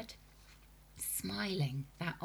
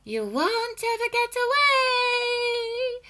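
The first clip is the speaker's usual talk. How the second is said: very high, loud singsong hag; shrieking witch call; 11 dB louder, slower than usual. A narrator's reading. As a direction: call after him eerily. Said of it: "he heard her saying".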